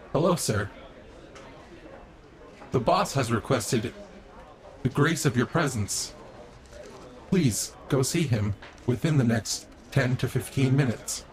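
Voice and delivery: soft, polite robot servant voice; a lightly voiced courteous robot; polite butler robot. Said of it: "your robot servant comes in".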